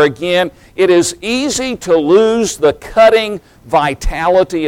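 fervent sermon delivery; preaching. Acted. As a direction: drive the point home from the pulpit. Preaching the message.

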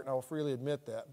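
ordinary talk

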